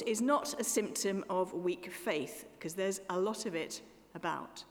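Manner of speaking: ordinary talk, nothing marked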